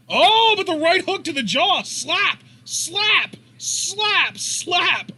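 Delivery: high-pitched, punched exclamations; excited play-by-play. Real, not acted. Commentator reacting to a flurry of slaps, excited high calls. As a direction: call each blow high and sharp. Live call of repeated slaps.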